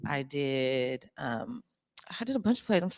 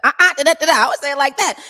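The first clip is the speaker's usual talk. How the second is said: high, loud, animated mock line reading; retold eager demo; 12 semitones higher, 12 dB louder than usual. Actress retells showing the director her way, loud and animated. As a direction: belt it out high and loud in your own way. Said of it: "And I'm like".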